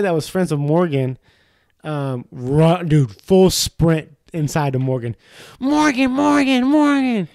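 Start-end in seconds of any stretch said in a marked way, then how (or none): 5.6-7.3 high, repeated alarm call; mock frantic name-calling; urgent calling. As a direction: imitate running in to raise the alarm. Retold runner calling his friend.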